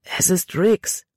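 slow, groaned alarm; groaning. A narrator's reading. Runaway slave spotting his cruel master, groaning. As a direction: groan it out slowly, in understated dread. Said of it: "Jordan stöhnte".

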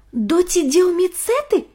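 high eager exclaim; excited nerd. Acted. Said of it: "воскликнул Ник".

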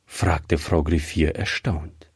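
plain reading voice

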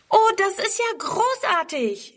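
begeistertes Mädchen, hoch und gedehnt; jubelnd. A narrator's reading. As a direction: juble langgezogen in hoher Stimme. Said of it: "jubelt Friedrun". (freudig jubelnd, sehr hoch)

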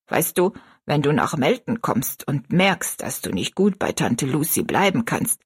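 put-on low, lilting old woman's voice; kindly bossy old woman. Old woman offering a girl a home, fondly firm.

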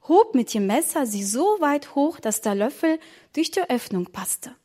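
plain reading voice

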